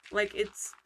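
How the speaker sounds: ordinary talk, nothing marked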